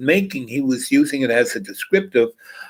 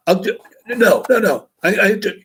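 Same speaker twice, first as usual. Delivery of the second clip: frantic pleading; 9 semitones higher, 3 dB louder than usual. Retold or imitated. Hustler begs for rematch.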